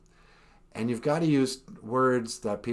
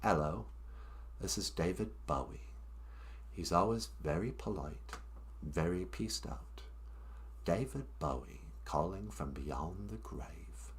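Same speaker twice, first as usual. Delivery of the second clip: polite English accent; 5 semitones lower, 14 dB quieter than usual. Shown as a demonstration. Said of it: "instead of saying hello, I say".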